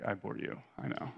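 dry, dropped-voice I bore you, half whispered; a son retelling his mom's sarcastic quip. Retold or imitated. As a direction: throw it off with mock smugness. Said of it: "she'd almost use it sarcastically".

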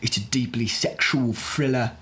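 slow, artsy Cockney pitch; lightly hammed-up Cockney; mock Cockney accent. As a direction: put on a pretentious Cockney drawl. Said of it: "In a Cockney accent".

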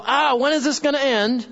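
worked-up plea, lightly hammed; agitated. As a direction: act out fretting at the state of the world. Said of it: "When you're agitated".

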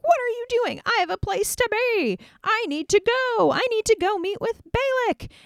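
high, whiny, impatient prophet voice; frantic Balaam. Acted. Balaam beating his stubborn donkey, high and exasperated. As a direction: pitch it way up, flustered and in a hurry. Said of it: "Balaam starts beating the donkey like".

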